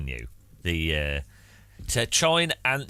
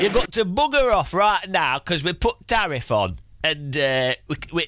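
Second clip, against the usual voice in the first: mock-gruff Northern English accent; telling raiders to clear off, Northern English style; 3 semitones higher than usual. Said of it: "Northern Voice".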